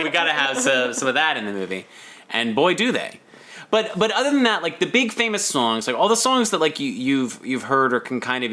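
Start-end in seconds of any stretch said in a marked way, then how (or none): none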